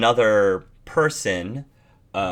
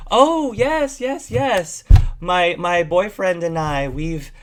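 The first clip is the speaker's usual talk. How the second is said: much higher, gushing agreement; eager bat woman; 5 semitones higher, slower than usual. Acted. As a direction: play along too eagerly.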